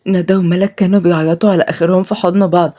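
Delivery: ordinary delivery, nothing marked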